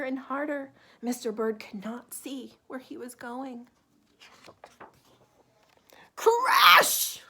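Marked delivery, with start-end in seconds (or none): 6.2-7.2 high, loud shouted crash. A narrator's reading. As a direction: bang it out as a sudden impact. Bird crashes in the storm.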